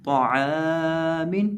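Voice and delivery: तो आ मिन chanted very slowly, heavy तो; drawn-out recitation. Shown as a demonstration. Teacher joins the spelled letters for students, slow chant. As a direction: hold every vowel long as in tilawat. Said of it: "मीम दो देर मिन".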